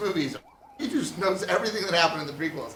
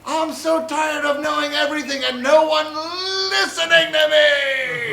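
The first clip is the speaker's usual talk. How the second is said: R2-D2; 11 semitones higher, 10 dB louder than usual. Acted. R2-D2 ignored by everyone, exasperated scream. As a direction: build to a despairing yell. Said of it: "R two D two's like".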